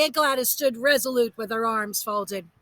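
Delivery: ordinary delivery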